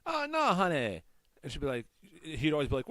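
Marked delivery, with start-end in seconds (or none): 0.1-1.0 high-pitched old-lady voice, imitated; imitating elderly woman. Imitating the woman he pranked, high protesting voice. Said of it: "she used to talk, she'd be like".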